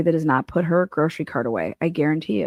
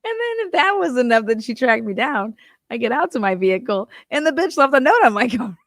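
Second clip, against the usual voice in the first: laughing while telling; 6 semitones higher than usual. Real, not acted.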